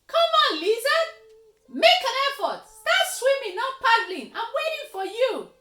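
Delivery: high, loud jeering yell across the water; gloating bellow from the far bank; taunting shout. Acted. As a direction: shout with all your might to mock him. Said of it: "taunting ... shouted with all his might".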